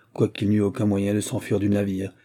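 ordinary delivery

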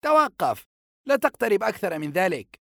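young guard's acted warning. A narrator's reading. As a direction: order a stranger to halt, pitched sharply up. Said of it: "يقول له محذرا".

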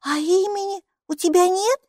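put-on high child's voice, pestering; persistent little girl. Майки, маленькая девочка, настойчиво расспрашивает Буку. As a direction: keep asking, won't let it go. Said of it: "не унималась Майки".

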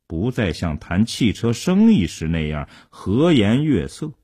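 plain reading voice

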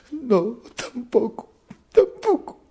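very high, drawn-out mock sob; weepier simpleton. Simpleton refusing the treasure, acted high weepy whine. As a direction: wail it slowly through put-on tears. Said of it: "Más lloroso y más".

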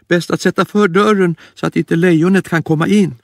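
very high, scared bunny voice; a tiny frightened rabbit squeaking; timid little rabbit. Acted. Lille Skutt hearing about the lion, high scared squeak.

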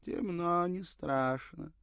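put-on high, slow old crone mutter; muttering old woman. A narrator's reading. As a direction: mumble like a bent old granny. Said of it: "пробормотала старушка".

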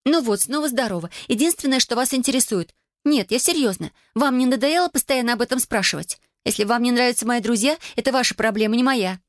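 put-on high-pitched, indignant girl's voice; exasperated teenage daughter. A narrator's reading. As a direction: argue back high and fed up. (возмущённый подросток, высоким голосом)

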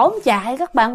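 ordinary delivery, nothing marked

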